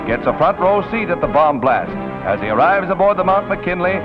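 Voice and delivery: ordinary talk, nothing marked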